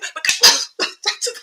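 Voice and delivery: helpless high giggling; laughing fit after mimicking someone; high laughter. Real, not acted. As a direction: crack up at your own impression. Cracks up at her impression.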